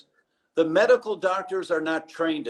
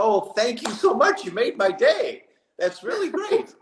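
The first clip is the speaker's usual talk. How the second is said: much higher, choked-up thank-you; teary thanks; 7 semitones higher than usual. Retold or imitated. Doctor tearfully thanking the patient who danced, high choked voice. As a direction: voice cracks up high, close to tears. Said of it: "I had tears in my eyes".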